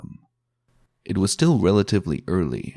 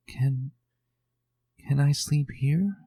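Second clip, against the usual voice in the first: higher, hesitant little filly voice; a small, unsure plea; timid filly; 6 semitones higher than usual. A narrator's reading. Timid filly asks to stay.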